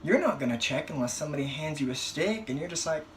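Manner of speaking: ordinary talk, nothing marked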